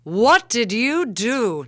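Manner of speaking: slow, loud sultry vamp line. Pretend. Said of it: "No, that was a vamp".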